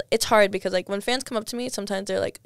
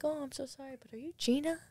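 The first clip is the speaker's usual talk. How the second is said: 1 polite Uber driver; 6 semitones higher, 12 dB quieter than usual. Retold or imitated. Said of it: "He's like".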